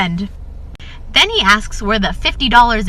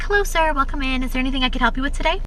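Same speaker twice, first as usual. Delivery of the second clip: higher-pitched, quick shop-clerk welcome; mock retail greeting in a raised pitch; customer service greeting; 4 semitones higher, faster than usual. Store worker to an arriving customer, customer service voice.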